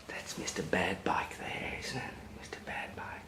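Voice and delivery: hushed whisper about the bad bike. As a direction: whisper it like a conspiracy. Secretive aside to child.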